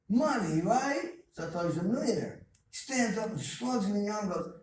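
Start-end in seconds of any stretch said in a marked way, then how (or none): 0.1-1.1 higher and louder, rising hopefully on right; a boy sure he's got it; eager kid's guess. Retold or imitated.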